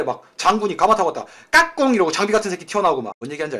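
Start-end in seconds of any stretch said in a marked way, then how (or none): 1.5-1.9 playful peekaboo shout. Retold or imitated. General pops out.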